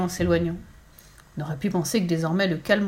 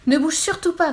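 high, loud urgent warning; acted shout, pitched up; crying out; 9 semitones higher, 5 dB louder than usual. A narrator's reading. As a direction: call out to stop someone moving. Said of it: "crièrent des gens".

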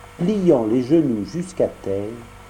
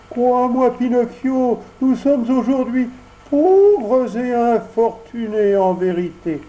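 tearful fox; 10 semitones higher, 4 dB louder than usual. Acted. Sly fox begs Pinocchio, whining with put-on tears. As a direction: beg with fake tears.

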